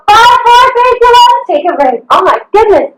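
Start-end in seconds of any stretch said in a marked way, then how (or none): 0.1-1.4 high, energized count; rallying countdown. Real, not acted. Trainer pushing class through the last seconds, excited countdown. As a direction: count them down to the finish, pitched up high.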